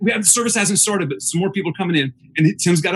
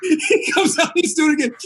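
laughing; 9 semitones higher than usual. Real, not acted. Laughing while retelling.

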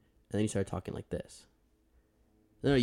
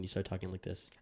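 "talking like this" said softer, turned away from the mic; 8 dB quieter than usual. Shown as a demonstration. Host showing his co-host how he sounds off-mic, softer. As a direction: let the words drift off the mic.

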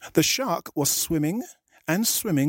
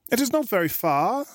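lightly raised storybook shark; 7 semitones higher than usual. A narrator's reading. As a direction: give the shark a higher friendly voice. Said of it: "Valentine's voices ... for the shark".

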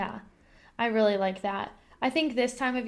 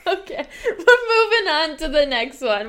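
high, loud, laughing through it; 4 semitones higher, 6 dB louder than usual. Real, not acted. Host moves on to next tip, laughing. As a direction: push on while cracking up. Moving on despite laughing fit.

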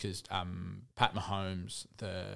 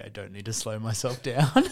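louder mocking voice of the co-host; mock quote of co-host; 8 dB louder than usual. Teasing co-host, quoting what he'd brag about. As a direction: quote him smugly, a bit louder. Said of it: "I thought you were gonna say".

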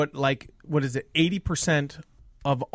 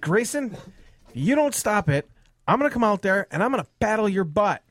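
higher-pitched retold parental threat; mildly mad dad; 6 semitones higher than usual. Dad retells threatening his son, mildly angry. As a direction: warn the kid with mock menace. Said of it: "I'm getting a little bit madder".

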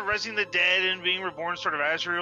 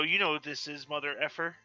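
low menacing threat; quiet, softer intimidation; intimidating; 4 semitones lower, 8 dB quieter than usual. Acted. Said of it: "I intimidate him and go".

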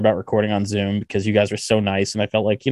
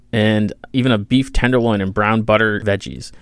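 ad read. Acted. Reads sponsor ad.

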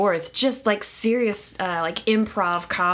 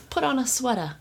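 put-on New York housewife accent; 5 semitones higher than usual. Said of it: "I was like, the".

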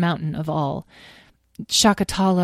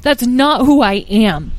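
firm; 6 semitones higher, 7 dB louder, slower than usual. A narrator's reading. Answers his own doubt firmly.

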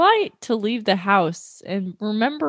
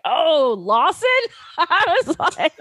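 laughing; 7 semitones higher than usual. Retold or imitated. Laughing recognition of Lawson's company.

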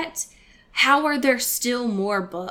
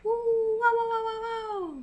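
muffled wah wah talk; 13 semitones higher than usual. Pretend. Sound effects after patron thanks.

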